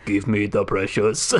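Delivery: slow, deliberate action-star Smeagol; Arnold Schwarzenegger. Acted. Action star ordering someone to hand it over, slow Austrian voice. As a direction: demand it heavily, Austrian accent. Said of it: "Smeagol from The Lord of the Rings".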